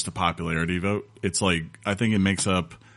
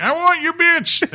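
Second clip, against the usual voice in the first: high, slow crazy prospector voice; old prospector; 6 dB louder, slower than usual. Pretend. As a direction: squeal it like a mad old miner. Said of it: "I'm like".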